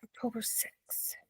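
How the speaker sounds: soft whispered date; whispering. Real, not acted. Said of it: "unvoiced whisper".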